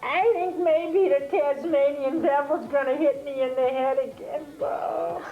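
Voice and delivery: Axel the Alligator voice. Shown as a demonstration. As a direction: drawl it out like a worried cartoon gator. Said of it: "who says".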